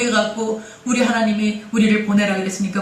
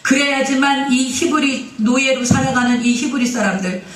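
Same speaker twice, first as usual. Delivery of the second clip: normal talking voice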